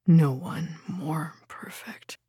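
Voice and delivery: low, slow, hushed praise; tender lover. A narrator's reading. Lover praising her between kisses, tender. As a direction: murmur it softly and slowly, adoringly.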